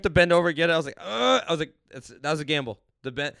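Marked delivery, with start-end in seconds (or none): 1.0-1.4 loud, higher effortful "ugh"; straining groan. Retold or imitated. Bending over to grab the keys, strained groan. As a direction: re-enact a risky, strained bend.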